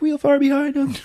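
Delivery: high, small straggler voice; high mock-feeble voice; Thane. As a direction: squeak it from the back of the pack. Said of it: "And Thane's, like".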